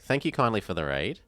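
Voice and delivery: normal talking voice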